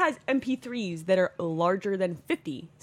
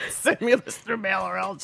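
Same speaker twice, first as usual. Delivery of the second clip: creepy stalker voice. Acted. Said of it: "creepy stalker voice".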